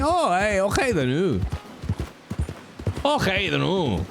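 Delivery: hammed-up Scots catchphrase; Scottish agreement. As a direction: agree heartily like a caricature Scot. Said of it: "said by a Scottish person".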